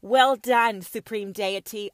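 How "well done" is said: ordinary delivery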